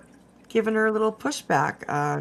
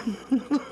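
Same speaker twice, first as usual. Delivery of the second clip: giggle; 6 semitones higher than usual. Real, not acted. Can't stop laughing.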